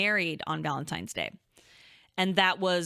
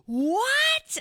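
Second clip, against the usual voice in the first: shrieked high "What?"; imitating mom's stunned squeal; shocked mom; 5 dB louder than usual. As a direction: mom can't believe the news. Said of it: "And she goes".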